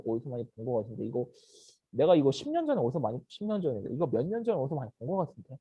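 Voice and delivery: low, soft puzzled muttering; murmuring. Acted. Bystander who half recognises the man, murmuring. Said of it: "사람들이 수군거리기 시작하는 거예요".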